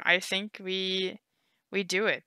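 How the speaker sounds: usual speaking voice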